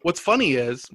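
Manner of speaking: ordinary talk, nothing marked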